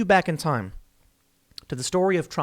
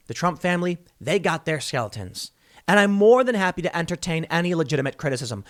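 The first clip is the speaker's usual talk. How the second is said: ordinary talk, nothing marked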